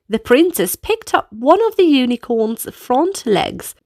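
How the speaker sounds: plain reading voice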